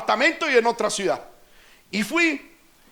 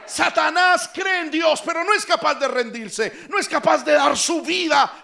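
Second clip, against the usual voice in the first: preaching at full force; 4 semitones higher than usual. Real, not acted. Preacher shouting to the congregation. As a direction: hammer the point home to the church.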